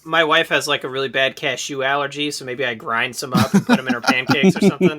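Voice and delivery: ordinary talk, nothing marked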